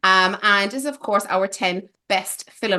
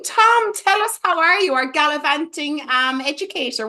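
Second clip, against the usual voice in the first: red carpet host; 7 semitones higher, 3 dB louder than usual. Pretend. Red carpet interviewer bit.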